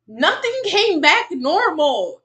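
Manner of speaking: slow, loud, high and emphatic; exasperated insistence. Real, not acted. Streamer repeating it so it sinks in, exasperated. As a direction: spell it out loudly, every word stretched. Repeats the contradiction more slowly.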